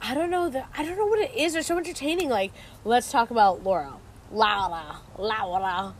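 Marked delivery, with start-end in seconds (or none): 4.3-5.0 "Laura" with a drawn-out ow vowel, lower; imitated pronunciation. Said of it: "how do they say her name". 5.2-5.9 "Laura" stretched toward "LAH-wuh-na", softer; imitated pronunciation. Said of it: "how do they say her name".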